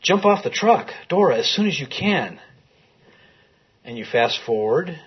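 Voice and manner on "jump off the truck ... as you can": higher, raised calling out to a wife on a truck; pitched-up retold yell, not full volume; retold shout. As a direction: call after her as the truck pulls away, a mild mock shout. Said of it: "he shouts to her".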